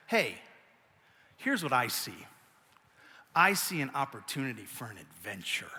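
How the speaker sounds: soft, low reassuring imitation of wife; gentle wife. Wife gently reassures her sulking husband in the truck, soft and low. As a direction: lightly imitate a calm wife soothing. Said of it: "also says".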